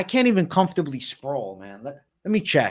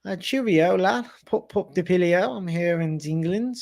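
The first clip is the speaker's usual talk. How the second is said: higher-pitched hammed-up English accent; attempted English accent, pitched up; 5 semitones higher than usual. Shown as a demonstration. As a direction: put on a bad, cheery English accent. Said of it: "do your best English accent".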